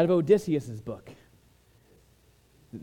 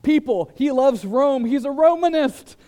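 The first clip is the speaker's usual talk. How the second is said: much higher, louder accusing cry; hammed-up denouncer rousing the crowd; mock outrage; 9 semitones higher, 6 dB louder than usual. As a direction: whip up the crowd against him. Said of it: "they're gonna be like".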